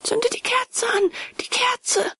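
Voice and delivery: cracked, very high choirboy pitch; desperate high-pitched plea; voice breaking. A narrator's reading. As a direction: let the voice break upward as you beg. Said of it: "seine Stimme brach, wie sie es manchmal bei einem Chorknaben tut".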